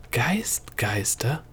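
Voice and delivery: whispered, wondering question. A narrator's reading. Princess echoing the gnome's strange word, whispered. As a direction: breathe the word in disbelief.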